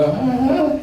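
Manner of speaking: high little imitated reply; young self replying. Boy answers the cops about school, high imitated murmur. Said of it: "I go".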